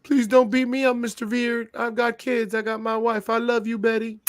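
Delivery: high-pitched, hammed-up begging for mercy; exaggerated high, panicky plea; mock pleading. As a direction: plead like a terrified wrestler, played for laughs. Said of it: "he's all like".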